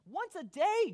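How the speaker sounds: high, loud proud boast; boastful exclamation. Acted. Peter trying to impress Jesus, boastful. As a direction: punch it up high, showing off.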